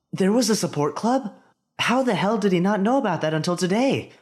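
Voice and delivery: high, startled disbelief; surprised inner thought. A narrator's reading. Boy spotting a support club, surprised and loud. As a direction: blurt it out in sudden surprise.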